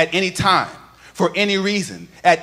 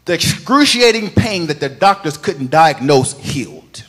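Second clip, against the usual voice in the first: full-force preaching; 3 semitones higher, 12 dB louder than usual. Acted. Pastor firing up the congregation about healing, loud chanted preaching. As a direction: lift the whole church with a sung, rising proclamation.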